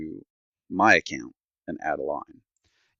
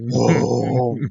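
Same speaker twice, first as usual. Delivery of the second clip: hammed-up outraged oh, a bit louder; mock rage; 4 semitones higher, 6 dB louder than usual. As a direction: play the fury for laughs. Retold customer service fury.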